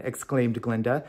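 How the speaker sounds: plain reading voice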